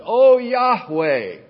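loud, high, drawn-out call; crying out to God. Acted. Preacher voicing the psalmist's prayer to Yahweh, crying out. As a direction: stretch the invocation out loudly.

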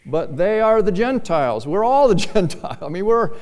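loud, high, impassioned sermon delivery; full-force preaching. Real, not acted. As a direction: raise the voice and hammer it home.

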